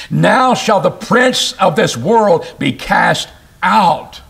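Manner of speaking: "Now" stressed, slow; slow weighty scripture reading; slow solemn proclamation. Acted. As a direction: proclaim it slowly. Preacher reads judgment verse.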